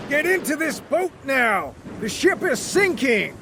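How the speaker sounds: high, pressing shouted order; captain urging. Acted. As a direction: urge him into the boat, high-pitched. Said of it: "shouted the captain".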